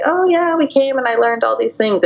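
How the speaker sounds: high, sing-song mock enthusiasm; mock cheerful. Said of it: "people are like".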